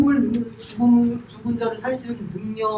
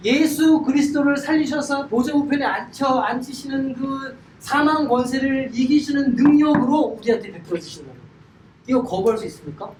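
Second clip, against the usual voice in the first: ordinary delivery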